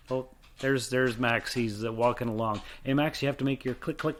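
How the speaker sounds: usual speaking voice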